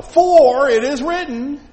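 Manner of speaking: high, loud, slow taunting; hammed-up devil. Devil tempting Jesus at the temple, high loud slow taunt. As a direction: draw out the devil's challenge. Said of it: "the next temptation is".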